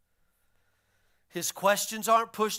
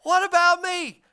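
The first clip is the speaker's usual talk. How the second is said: higher, pleading what about me; mock complaint, pitched up; whiny left-out disciple; 8 semitones higher, 6 dB louder than usual. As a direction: whine like the one who missed out. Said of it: "Neil's like".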